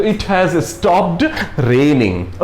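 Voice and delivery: very slow, word by word; slow example read. Shown as a demonstration. Teacher showing the perfect tense example, very slow. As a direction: spell the sentence out slowly for learners. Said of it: "पूर्ण हो चुका है".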